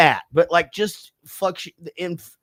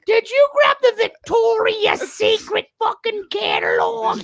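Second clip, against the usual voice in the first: Ethel impression; 4 dB louder than usual. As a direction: drag out a high screeching shout. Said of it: "That is not what Ethel sounded like".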